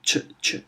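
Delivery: Ch said alone, unvoiced; clipped Ch sound. Shown as a demonstration. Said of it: "it's the Ch".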